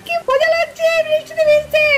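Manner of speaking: squeal-like delight at getting soaked; gleeful delight. Acted. Child playing in the rain with a sibling, gleeful.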